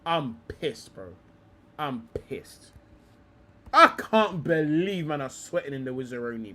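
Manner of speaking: ordinary delivery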